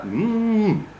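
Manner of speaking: long high hum that falls away; peak and trough. Shown as a demonstration. Teacher shows Russian contour, swooping hummed peak and trough. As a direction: hum one big swoop, high then down. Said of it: "it's a peak and trough".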